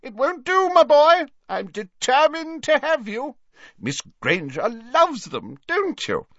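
very high, booming theatrical professor; hammed-up hearty host pressing his invitation; jovial, insistent Slughorn. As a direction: insist grandly, pitch soaring.

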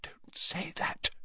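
hushed, soft plea; whispered. A narrator's reading. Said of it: "he whispered".

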